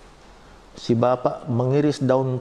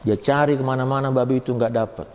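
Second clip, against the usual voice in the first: ordinary talk, nothing marked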